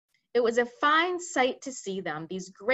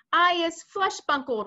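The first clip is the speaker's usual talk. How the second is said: higher, louder giant's roar; 8 semitones higher, 5 dB louder than usual. Acted. Fleshlumpeater roaring while tied on the ground. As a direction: bellow it like a trussed brute. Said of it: "roared the flesh lump eater".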